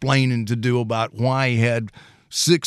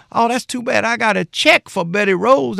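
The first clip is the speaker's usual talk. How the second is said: dad's voice, pitched higher and louder; imitating dad; 12 semitones higher, 5 dB louder than usual. Utility man dad tells the porch about a check, lightly voiced. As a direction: play the sly utility man.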